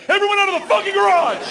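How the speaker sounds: high, loud outraged yell; furious shout. Retold or imitated. Homeowner yelling at intruder in his garage, furious shout. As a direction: blast everyone out like an angry owner. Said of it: "homeowner had to come out and be like".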